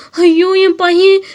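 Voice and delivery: slow, high, loud cry of despair; anguished wail. Acted. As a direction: wail it out for the lost son. Mother's first outcry at the news.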